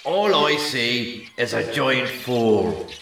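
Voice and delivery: roaring back. A narrator's reading. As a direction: hurl the insult back. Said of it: "he roared back".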